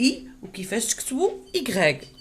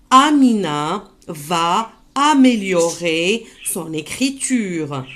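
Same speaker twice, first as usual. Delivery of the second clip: slow model reading; 2 semitones lower, 3 dB louder than usual. Shown as a demonstration. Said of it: "Je répète".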